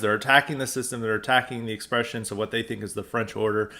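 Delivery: usual speaking voice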